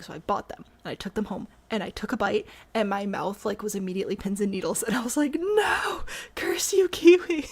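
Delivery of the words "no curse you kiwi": shouted, much higher and louder; mock outrage. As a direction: yell at the kiwi in mock fury. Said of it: "I was so mad".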